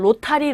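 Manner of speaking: 로타리 with a clear Korean t, "ro-ta-ri"; 로타리 in three even syllables with a hard t; Koreanized rotary. Shown as a demonstration. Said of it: "이렇게 발음하는데".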